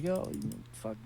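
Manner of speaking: voiceless, soft mock menace; whispered threat. Imagined stranger, whispered menace. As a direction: hiss it like a quiet threat. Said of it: "I didn't know if he was like".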